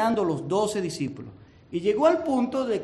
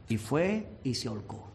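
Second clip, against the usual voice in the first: normal talking voice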